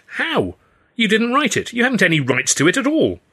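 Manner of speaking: high indignant protest; outraged at the claim. A narrator's reading. Stan protesting to Hurley, outraged.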